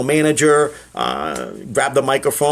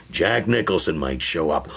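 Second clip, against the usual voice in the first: Jack Nicholson impression; 10 semitones lower, 5 dB quieter than usual. Shown as a demonstration. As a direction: purr it like a smirking rogue. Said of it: "I pepper it with all impressions".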